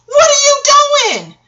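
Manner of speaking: high, loud cry of utter shock; shocked yell. Retold or imitated. Mother shouting at her skating son across the street, shocked cry. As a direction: yell his name over rush-hour traffic in disbelief. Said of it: "In fucking utter shock".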